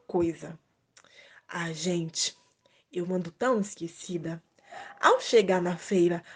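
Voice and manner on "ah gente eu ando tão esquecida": whispered aside. Real, not acted. Aside to kids about forgetting.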